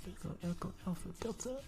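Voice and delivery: high, soft, playful character voice; sing-song it high and softly; put-on high voice. Streamer goofing about [name], high soft voice.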